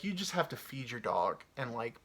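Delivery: ordinary delivery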